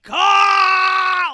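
long, high, loud theatrical scream; hammed-up cry for vengeance; vengeful scream. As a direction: scream out his name in acted fury. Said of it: "you scream out his name in anger and vengeance".

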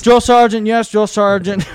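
loud, high mock recruit response; imitating an obedient barked reply; recruits answering. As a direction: sound off in unison like a recruit. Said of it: "then we're all like".

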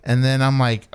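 ordinary delivery

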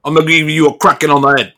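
loud, threatening town guard; guard threatening the armed, bleeding stranger, loud; tough guard. Acted. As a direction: square up like a bully in uniform. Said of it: "and he goes".

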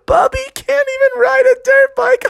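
very high, squeaky mocking voice; laughing kids. Siblings mocking Bubby after the crash, squealed laughing taunt. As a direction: jeer through hysterical giggles. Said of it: "were rolling around on the ground".

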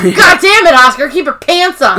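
high, loud mock-angry snap; hammed-up exasperated outburst; biting someone's head off. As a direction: act out a furious rebuke. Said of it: "She bites Oscar's face off".

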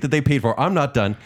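usual speaking voice

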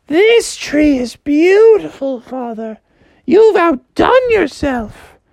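high, slow doting mother voice; mother frog praising father's tree, high and slow. Acted. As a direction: gush over it sweetly and slowly.

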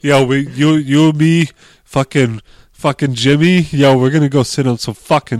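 put-on loud Boston bro accent; rally the crew in a thick Boston accent; brash put-on Boston accent. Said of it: "the best Boston accent you're gonna get".

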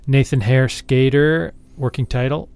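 normal talking voice

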